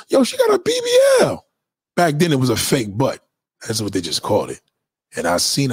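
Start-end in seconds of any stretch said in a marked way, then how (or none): 0.1-1.4 friend's shocked shout. Retold or imitated. Friend shouting the news about her body, high excited yell. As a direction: blurt it out like a stunned friend. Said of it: "And my man was like".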